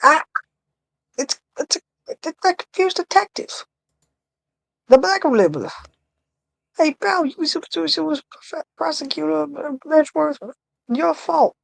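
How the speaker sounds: high-pitched Brooklyn tough-guy voice; squeaky put-on Brooklyn detective accent. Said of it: "I'll try it".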